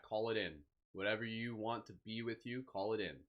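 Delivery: calm, unhurried meditation voice; guided meditation. Acted. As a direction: ease listeners gently into stillness.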